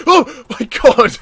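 a loud laughing exclaim; startled laugh. Real, not acted. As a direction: shriek in surprise, laughing. Startled by zombies.

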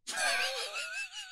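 high squealing laugh breaking out; burst of laughter. Real, not acted. Telling mate about the dog, bursts into high laughter. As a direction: crack up at the punchline.

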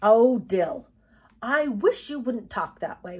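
loud, dismayed opening, then plaintive; mild wail. A narrator's reading. Girl reproaching her cousin, mildly wailing. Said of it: "wailed Jody".

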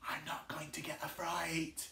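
whispered to self; whispered aside. Acted. Teacher whispering to herself to stay brave.

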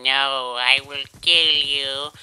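the cold that won't give up, threatening to kill; the cold talking. Acted. Sick guy voicing his cold as a slow, loud villain. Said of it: "It's just holding on and saying".

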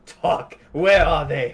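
hard, loud demand; menacing interrogator. Acted. Spooky Brazilian woman grilling the players, menacing. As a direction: lean in and demand answers.

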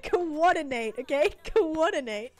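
loud, high mock scolding; loud playful telling-off; exasperated command. Real, not acted. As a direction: plead with the chat, amused and exasperated. Begs viewers to coordinate likes.

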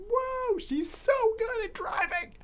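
high, drawn-out fangirl gasp; swooning fan. Retold or imitated. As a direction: gush like a starstruck fan. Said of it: "Gonna be like".

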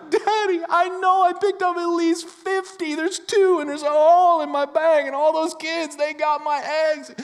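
hammed-up little boy wail, very high; loud, high-pitched mock crying; imitating sobbing child. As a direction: wail to Daddy like a heartbroken five-year-old. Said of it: "and he's sobbing".

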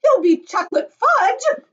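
high, loud, drawn-out shriek. A narrator's reading. Mother fears fudge fate for son, shrieking. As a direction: shriek in horror. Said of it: "shrieked Missus Gloop".

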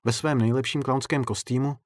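ordinary delivery

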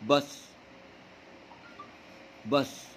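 "بس" with the vowel snatched short; clipped short vowel. Shown as a demonstration. Teacher showing students the short u in "بس", clipped. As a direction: cut the u in "بس" quick and short. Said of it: "يعني ايه بخطفه؟".

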